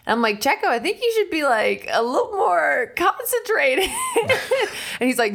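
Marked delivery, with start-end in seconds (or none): 0.4-3.9 high, laughing, half-crying plea to the driver; giggling passenger begging the driver to concentrate; laughing nervous plea. Retold or imitated. Nervous passenger joking at speeding driver. 3.9-4.9 a burst of high-pitched laughter; real high giggle. Real, not acted. Laugh alone on a line.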